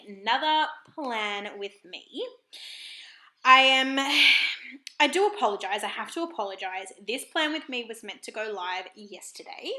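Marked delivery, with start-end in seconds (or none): none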